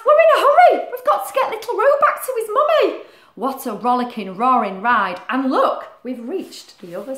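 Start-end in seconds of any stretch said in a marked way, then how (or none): 0.1-3.0 higher and louder, laughing; excited hurry. Acted. Animals hurrying Roo home, high and excited. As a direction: rush along breathless with fun.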